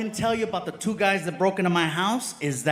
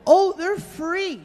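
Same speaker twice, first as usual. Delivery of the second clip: chirpy barista; 11 semitones higher, slower than usual. Retold or imitated. Barista's cheerful answer.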